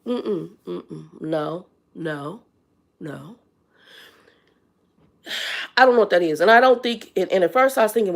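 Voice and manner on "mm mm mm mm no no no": whispered, fading no, no, no; whispered refusal. Retold or imitated. Shopper caught pushing in, whispering a sheepish denial. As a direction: back off in a breathy whisper, shaking your head. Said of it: "And he said".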